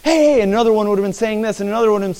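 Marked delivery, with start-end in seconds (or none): 0.0-0.4 high, urgent frantic calls; panicked disciple. Acted. A disciple waking Jesus in the storm, high and frantic. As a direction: shake a sleeping man awake in panic. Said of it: "One of them would say".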